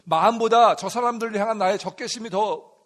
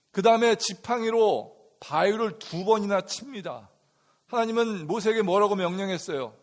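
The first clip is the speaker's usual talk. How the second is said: ordinary delivery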